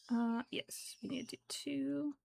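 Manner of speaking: soft whispered counting; whispering. Real, not acted. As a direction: whisper it to yourself. Whispered counting while crocheting.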